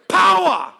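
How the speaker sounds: POWER belted loud; whole-body shout. Shown as a demonstration. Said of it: "Takes your whole body to say it like an African".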